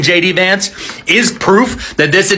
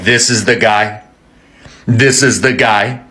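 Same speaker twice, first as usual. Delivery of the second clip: much lower, heavy voice; grim old Pope; 10 semitones lower than usual. Acted. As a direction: say it like a grim verdict.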